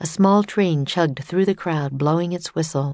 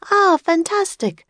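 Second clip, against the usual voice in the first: high, loud thrilled exclamation; delighted passenger; 12 semitones higher, 4 dB louder than usual. Acted. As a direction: gasp with delight. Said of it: "a train passenger said".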